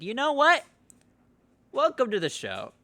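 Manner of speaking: hammed-up British accent, pitched high and loud on the opening; terrible British accent. Co-host mocks the prospects' welcome in a bad British accent. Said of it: "That was a fucking terrible accent".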